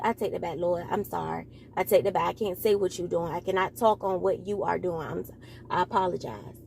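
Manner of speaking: quiet apology to God. Real, not acted. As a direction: drop the voice and apologise to the Lord quietly. Quiet apology to God mid-talk.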